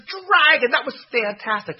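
high, loud, thrilled; excited praise. A narrator's reading. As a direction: gush with delight. Elizabeth praises.

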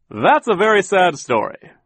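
high, loud waiter voice; waiter butting in. A narrator's reading. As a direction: butt in with big, swooping sympathy. Waiter butts in with sympathy.

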